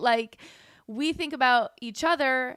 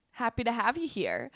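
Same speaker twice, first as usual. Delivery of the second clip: ordinary delivery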